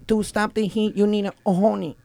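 halting, softened bad Filipino accent; attempted Filipino accent. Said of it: "That was a very interesting Filipino accent".

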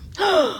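short, high gasp; quick, soft intake of shock; sudden high-pitched gasp. Real, not acted. Shocked listener reacts to revelation.

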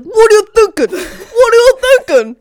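high, loud mock yell at a worker; mock angry yelling. Imitating the TV boss yelling at a kid, mock angry shout. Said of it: "And he's like".

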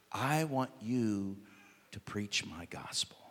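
slow, soft whisper of God's voice; whispered call. Acted. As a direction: breathe it as a holy secret.

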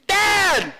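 high, loud yell for Dad; kid's yell. Retold or imitated. Kids calling a father who can't hear well, yelling. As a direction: imitate a kid bellowing for Dad. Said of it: "sometimes they'll go".

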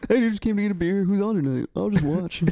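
high, loud, breezy dude voice; casual comic voice. Retold or imitated. Comic imitating a stand-up who wanders into the bar, high and breezy. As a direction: play a laid-back comic dropping in for a beer.

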